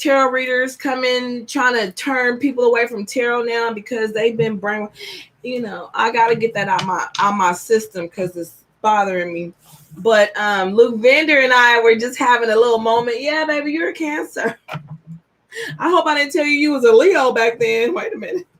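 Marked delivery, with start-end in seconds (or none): none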